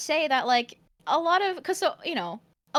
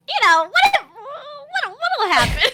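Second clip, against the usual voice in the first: high, eager wondering; curious kid voice; 9 semitones higher, 5 dB louder than usual. Retold or imitated. Teasing friend imitates her curious young self. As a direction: play the kid asking what happens. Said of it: "I like that you were just like".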